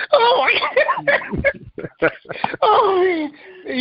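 high, gasping words through laughter; helpless laughter. Real, not acted. Host reacting to Joe's bathroom piece, helpless laughter. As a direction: laugh till it nearly turns to crying. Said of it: "I'm laughing so hard".

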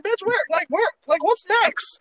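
high, loud mock outburst at the year; screeching what now at the universe; exasperated shriek. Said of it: "I'm like".

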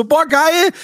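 excited. Retold or imitated. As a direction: squeal with joy over the gift. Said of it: "Ich so".

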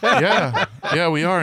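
high, loud, laughing yeah; laughing reply. Retold or imitated. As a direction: laugh it off as you insist. Laughing reply in retold story.

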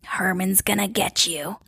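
put-on eerie whisper of a child spirit; hushed, menacing ghost-kid whisper; growled whisper. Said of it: "growl whispered saying".

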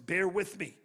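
ordinary talk

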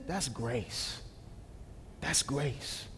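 very low and soft; hushed reverence. Acted. Preacher revealing God's grace to the congregation, hushed and reverent. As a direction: drop right down, let it land gently. Preacher lands the point softly.

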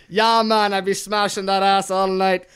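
loud, badly faked Jamaican accent. Friend redoes the card after being told it sounded Jamaican, bad Jamaican accent. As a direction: ham up a clumsy Jamaican accent. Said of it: "That was a bad Jamaican accent".

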